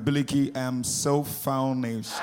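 slow, soft "famished" drawn out; faking a weak tired voice; hammed-up famished girl; slow mock complaint. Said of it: "they tell him friend".

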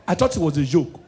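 ordinary talk

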